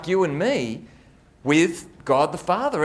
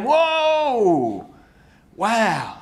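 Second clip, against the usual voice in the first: amazed whoa; 6 semitones higher than usual. Real, not acted. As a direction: gasp it out in wonder. Amazed exclamation after rereading.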